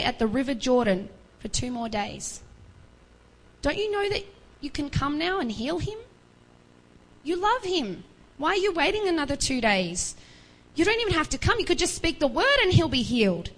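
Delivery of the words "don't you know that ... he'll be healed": pleading question. Acted. Preacher pleading with Jesus.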